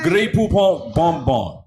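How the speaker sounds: loud, high fake French; fake French accent. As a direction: ham up French words.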